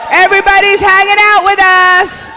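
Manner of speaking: high, loud hype call to the crowd; rally the room; hype shout. Real, not acted. Host calling out to the party crowd, loud hype shout. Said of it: "host hyping the crowd".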